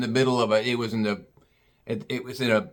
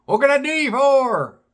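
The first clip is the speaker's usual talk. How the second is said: high hillbilly voice, repeated for a confused customer; country bumpkin repeating; 12 semitones higher, 4 dB louder than usual. Retold or imitated. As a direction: say it again in the bumpkin voice. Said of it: "He goes".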